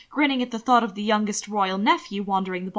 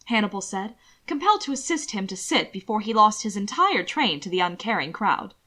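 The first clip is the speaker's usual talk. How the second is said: ordinary delivery, nothing marked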